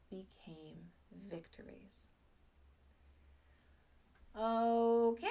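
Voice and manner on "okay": a loud, bright rising okay. Real, not acted. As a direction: call it out full of energy. Springing back up, energized.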